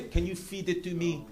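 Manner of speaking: put-on French player's request; French accent. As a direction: imitate an entitled Frenchman asking. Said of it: "like, French".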